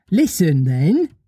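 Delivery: lightly voiced little mother beginning her tale; Wendy telling a story. Figures voiced in story reading.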